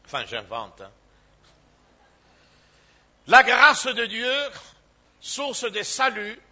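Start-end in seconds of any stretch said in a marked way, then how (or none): 3.3-6.4 preacher announcing his sermon's theme, loudly; loud announcement. Acted. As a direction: proclaim "La grâce de Dieu" much louder, ringing out.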